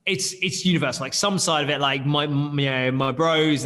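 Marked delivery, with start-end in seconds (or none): none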